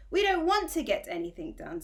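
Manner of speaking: curt cross retort; snapped angrily. Acted. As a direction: bite back at the boy, annoyed. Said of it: "snapped another angrily".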